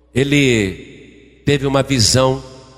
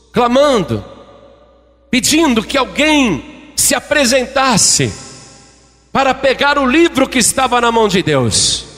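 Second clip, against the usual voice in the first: raised, high preaching voice; fervent preaching; 6 semitones higher than usual. Acted. Preacher telling of the angel's plea, fervent and high. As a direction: preach it with rising urgency.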